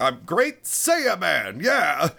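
high, slow triumphant hero voice; hammed-up proud announcement, stretched out; Great Saiyaman. Hero naming himself to villains, grandly triumphant. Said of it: "as he goes, like".

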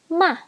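"ma" high, then falling; falling fourth tone. Shown as a demonstration. Teacher running through tones, "ma" falling. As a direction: drop "ma" from high. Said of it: "Fourth tone".

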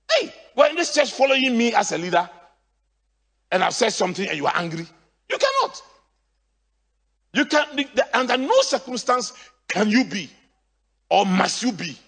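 loud, high preaching voice; preaching at full force. Real, not acted. Pastor rebuking angry church members, preaching loudly. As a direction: thunder it from the pulpit. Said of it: "Oh, am I preaching?".

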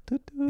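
high cheery toot-toot; train whistle. Shown as a demonstration. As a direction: toot it out like a happy little train. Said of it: "It's a more, like, positive train".

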